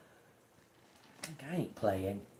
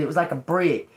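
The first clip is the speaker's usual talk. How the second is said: ordinary talk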